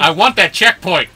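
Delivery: loud, high gamer demand; frustrated shout. Real, not acted. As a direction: yell it at the game. Frustrated after losing in game.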